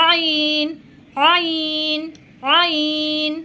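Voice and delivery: আইন squeezed from the middle of the throat; throaty Arabic ain. Shown as a demonstration. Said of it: "গলার ঠিক মধ্যখান থেকে".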